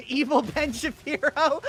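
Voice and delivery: high, loud, laughing through the name; can't hold the giggles. Real, not acted. Joking to chat about Ben Shapiro, laughing. Cracking up at own bit.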